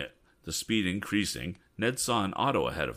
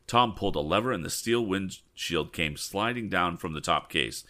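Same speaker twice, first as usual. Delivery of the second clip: ordinary delivery, nothing marked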